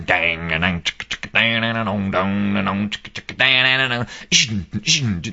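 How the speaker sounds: mouthed guitar and drum noises; imitated drum riff. Storyteller voicing the tune in Tim's mind, mouthed riff. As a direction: chug out the riff with your mouth.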